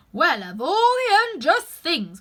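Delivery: slow, loud high protest; indignant. Acted. Seven protests unfairness, slow and loud. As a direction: splutter with outrage.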